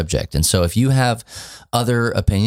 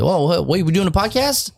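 put-on kid voice; 11 semitones higher than usual. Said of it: "he ... decided".

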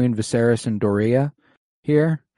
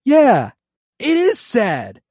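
mock angry Viserys; 7 dB louder than usual. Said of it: "And he's like".

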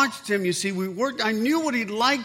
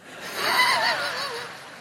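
bright high burst of laughter; a happy brother's laugh; joyful high laugh. Retold or imitated. Brother laughs in retold scene.

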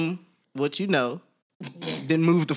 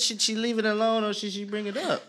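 ordinary talk, nothing marked

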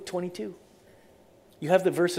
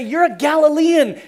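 high, raised accusing voice; loud accusation; 9 semitones higher, 10 dB louder than usual. Acted. Preacher as bystanders exposing Peter's origins, loudly accusing. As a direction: call him out loudly.

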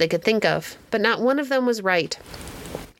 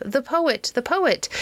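excited higher calls of the poet; cheering cries; 6 semitones higher, slower than usual. A narrator's reading. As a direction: cheer the poet, a touch higher each time. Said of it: "cheers and cries of".

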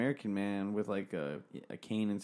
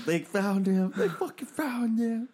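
high, slow, laughing through the words; cracking up while saying it; 10 semitones higher, 3 dB louder, slower than usual. Real, not acted. Laughing to his friend about the man they found. Laughing over grisly news.

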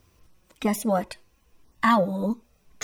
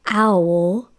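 plain reading voice

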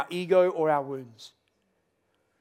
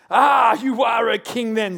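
loud, slow, higher proclamation; triumphant gotcha; 5 semitones higher, 7 dB louder, slower than usual. Acted. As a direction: pounce on it like you caught him. Pilate seizing on the word king.

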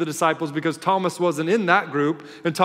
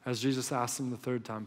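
ordinary talk

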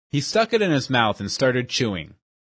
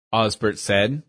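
plain reading voice

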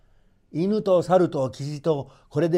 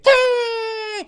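high, drawn-out bird screech; long high bird cry; pheasant shriek; 7 dB louder, much slower than usual. A narrator's reading.

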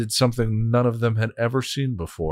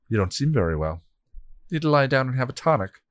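reasonable; 5 semitones higher, faster than usual. Acted. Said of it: "the bot asked reasonably".

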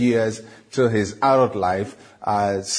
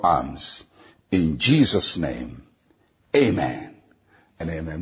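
led prayer; 4 semitones lower than usual. Acted. Pastor leading listeners in a repeat-after-me prayer, earnest.

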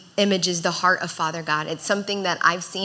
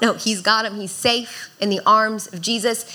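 usual speaking voice

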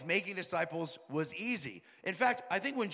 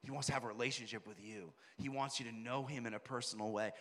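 tender appeal; 7 semitones lower, 9 dB quieter than usual. Retold or imitated. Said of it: "I go".

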